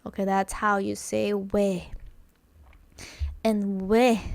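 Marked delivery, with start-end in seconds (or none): none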